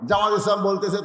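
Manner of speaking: normal talking voice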